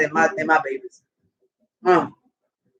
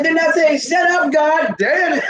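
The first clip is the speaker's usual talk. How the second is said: high, exasperated retold yell; fed-up mock yell; 10 semitones higher than usual. Ronnie fed up with all-night howling, high mock yell. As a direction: play up someone yelling at dogs. Retells Ronnie scolding the dogs.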